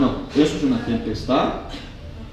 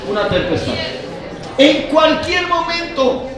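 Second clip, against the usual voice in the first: raised, forceful sermon voice; preaching; 8 semitones higher, 5 dB louder than usual. Real, not acted. Pastor telling the congregation of the boat in the storm, preaching. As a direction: preach it out loud and high to the whole room.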